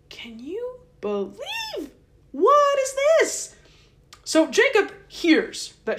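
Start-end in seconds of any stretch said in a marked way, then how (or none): 0.1-1.9 slow, high whispered disbelief; hushed, drawn-out disbelief; incredulous whisper. Real, not acted. Outraged aside to viewers. 2.3-3.5 high, loud, slow what is this; high loud incredulous cry; outraged disbelief. Real, not acted. Outraged aside to viewers.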